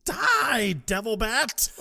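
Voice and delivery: high, loud comic scream; hammed-up panicked attack yell; mock battle cry. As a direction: shriek it as you hurl something at a monster. Acting Ace hurling medallion at bat.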